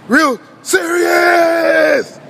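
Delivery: very slow, high, drawn-out cry; hammed-up howl of warning; wailed emphasis. Real, not acted. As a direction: stretch the last word into a long wail. Dramatic warning about the spicy noodles.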